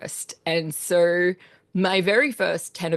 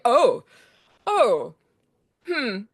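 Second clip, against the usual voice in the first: retold realization, raised "oh" trailing into a hmm; mildly shocked; 4 semitones higher, much slower than usual. Composer recalling first hearing the American pronunciation, mildly shocked. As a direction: react with surprise, then ponder it. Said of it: "a funny shock to me".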